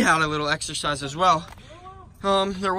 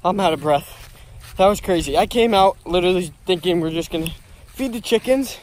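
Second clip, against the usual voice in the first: ordinary talk, nothing marked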